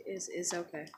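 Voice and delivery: very soft, low mumbled reply; subdued acceptance. Retold or imitated. Ashamed boy accepting apology, very quietly. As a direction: barely voice it, letting it drop.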